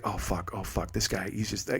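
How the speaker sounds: low, hushed swearing to himself; mock dread under the breath; hiding mutter. Imagined clerk hiding from big customer.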